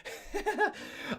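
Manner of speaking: high, soft chuckle; amused laugh. Real, not acted. Telling viewers about his old bike trip, amused laugh. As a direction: laugh at your own story.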